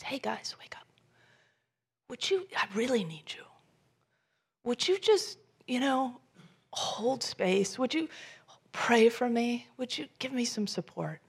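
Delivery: Jesus pleading with sleeping friends; whispered plea. Retold or imitated. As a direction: nudge them awake in a hush. Jesus waking sleeping disciples.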